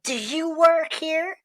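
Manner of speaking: high, drawn-out incredulous question; disgruntled customer. Acted. Customer challenging a store worker, disgruntled. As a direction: stretch it out and climb high. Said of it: "just like a disgruntled customer".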